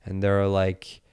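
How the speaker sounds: normal talking voice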